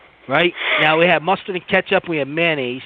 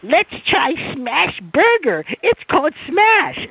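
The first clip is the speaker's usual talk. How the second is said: high, slow mock girlfriend voice; 11 semitones higher, slower than usual. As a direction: mimic her pitched way up and drawn out. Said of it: "But she's like".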